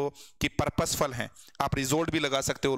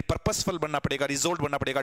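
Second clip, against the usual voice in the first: normal talking voice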